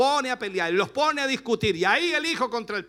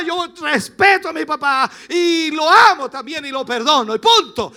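loud, forceful imitated son; shouting as the forgiving son; son's loud declaration; 4 semitones higher, 9 dB louder than usual. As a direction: declare it loud for the whole church. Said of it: "Y mi hijo dirá".

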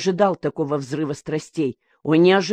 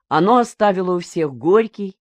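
ordinary delivery, nothing marked

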